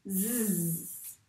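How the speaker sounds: Z as a buzzing zzz; Z sound. Shown as a demonstration. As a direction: hold the buzz on Z. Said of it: "On l'a prononcé comment?". (le son Z bien appuyé)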